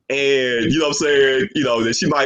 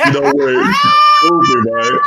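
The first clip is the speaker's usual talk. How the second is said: high, loud, helpless laughing shriek; cracking up; 6 dB louder than usual. Real, not acted. Co-host losing it at her crude joke, laughing hard. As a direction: squeal it through real laughter.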